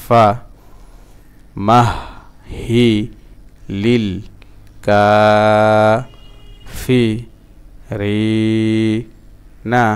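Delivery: ফা, মাহ, হি, লিল set apart by pauses; broken-up syllables. Shown as a demonstration. Teacher drilling learners again, halting syllable-by-syllable chant. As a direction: chant piece by piece, drawing out Kafi and রি. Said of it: "আবার ভাঙা ভাঙা উচ্চারণ".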